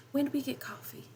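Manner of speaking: a hushed, delighted question; delighted surprise. A narrator's reading. Groggy mother surprised by the coffee, hushed delight. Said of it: "surprised by, almost delightedly".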